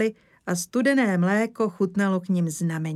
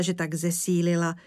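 plain reading voice

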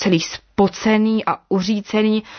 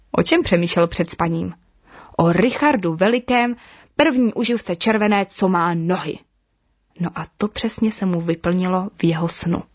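plain reading voice

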